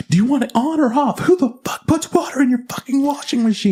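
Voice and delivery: high, exasperated rant; exasperated outburst. Retold or imitated. Frustrated worker ranting about the tenant, high-pitched. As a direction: let the frustration burst out high.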